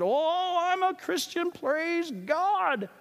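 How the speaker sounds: high, smug sing-song, mocking. Pastor mocking arrogant Christians, smug high sing-song. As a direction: sneer it like a self-satisfied believer.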